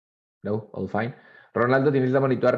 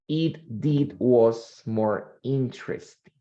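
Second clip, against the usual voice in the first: slow, deliberate read-aloud; reading slowly; much slower than usual. Shown as a demonstration. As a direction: read each phrase out slowly for the class. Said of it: "I'm going to read slowly".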